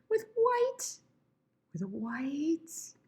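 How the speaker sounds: put-on high, thin old voice; trying out an elderly voice; old person's voice. Shown as a demonstration. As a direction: creak it out high like someone aged. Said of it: "gonna do an old voice".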